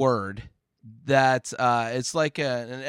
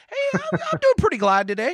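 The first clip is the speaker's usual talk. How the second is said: mock pretty glad; 4 dB louder than usual. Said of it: "I've never heard anybody say that before".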